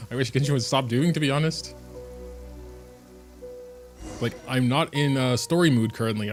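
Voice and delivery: ordinary talk, nothing marked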